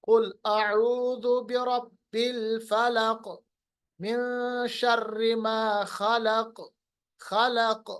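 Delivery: slow recitation, फलक and खलक echoing on क; qalqalah recitation. Shown as a demonstration. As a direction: draw it out, bounce every final क. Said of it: "ये काफ की आवाज वापस लाते हैं". (धीमी तिलावत, आख़िरी क़ाफ़ की गूँज)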